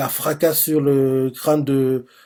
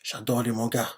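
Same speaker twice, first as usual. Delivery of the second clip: inner thought; 3 semitones lower, 7 dB quieter than usual. Retold or imitated. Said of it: "il fait".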